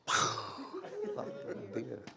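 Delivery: quiet high chuckle; soft laugh. Real, not acted. Laugh at end of story.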